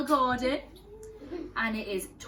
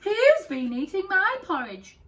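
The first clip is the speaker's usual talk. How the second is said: much higher, loud gasping mother bear; Mummy Bear gasping; 10 semitones higher, 8 dB louder than usual. Acted. Mummy Bear shocked at her eaten porridge, gasping. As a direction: gasp it out in shock. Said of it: "gasped Mummy Bear".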